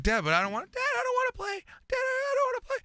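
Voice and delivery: put-on high, whining child's plea; squeaky childish protest; mock whiny kid. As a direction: whine like a kid begging dad. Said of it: "It's like".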